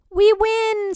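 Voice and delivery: very high, loud cry; screamed. Acted. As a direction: scream in triumph. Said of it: "screamed the guests".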